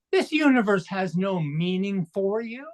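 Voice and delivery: usual speaking voice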